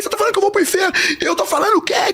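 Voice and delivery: very high, heated re-enacted quarrel; arguing. Two arguing over going to hell, heated. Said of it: "Começou a gente discutindo, brigando".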